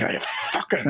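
hushed, seething mock curse; very quiet imitated self-directed anger; angry at himself. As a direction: mimic someone cursing under his breath. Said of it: "visibly just being like".

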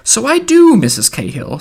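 put-on high wheedling old woman; Mother Grogan. A narrator's reading. Old woman answering her neighbour, high wheedle. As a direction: open very high and loud, wheedling. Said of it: "went on hewing and wheedling".

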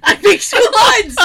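high, loud laughing exclamation. Real, not acted. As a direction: burst out laughing. Laughing at cohost's joke.

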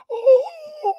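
very high, loud squeal; a sudden hammed-up yelp; mock high cry. A comic imitating his girlfriend's climax. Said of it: "She be like".

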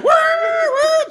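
high loud honking; imitated horns. Mimicking cars honking at the man in the street. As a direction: honk like the cars behind.

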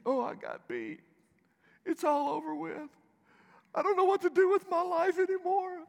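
high, wailing mock lament of a beaten wrestler; mock despair. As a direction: whine it out high like a man whose world just ended. Imagined despairing wrestler voiced.

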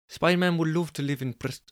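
high voice slipping toward Irish Indian; botched Scottish accent. Acted. Jessica answering Angus, Scottish accent drifting Irish Indian. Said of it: "sort of Irish Indian there".

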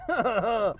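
a big laugh at his own line; hearty laugh. Real, not acted. Hyped coach laughing at his own riff. Self-amused aside mid-pitch.

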